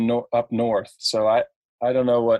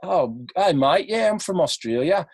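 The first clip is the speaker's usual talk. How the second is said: put-on higher-pitched, hammy Aussie accent; imitating an Australian, pitched up; 6 semitones higher than usual. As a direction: show off a fake Australian accent. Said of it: "showing off my Australian accent".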